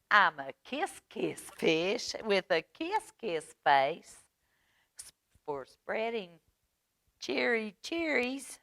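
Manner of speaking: sing-song refrain climbing high and loud; happy fish chant; cheerful kiss-kiss fish. Acted. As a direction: build to a triumphant, stretched "all over". Voices the fish's cheerful new refrain.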